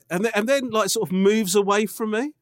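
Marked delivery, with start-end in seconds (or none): none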